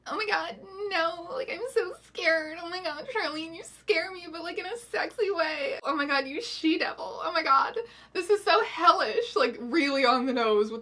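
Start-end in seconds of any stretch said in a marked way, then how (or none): 0.1-9.4 high, whimpering hammed-up fright; mock scared. Cynthia swooning at her she-devil, mock terrified and high. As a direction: ham up a breathless terrified girl. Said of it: "Cynthia is very, like".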